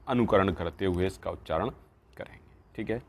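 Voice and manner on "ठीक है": normal talking voice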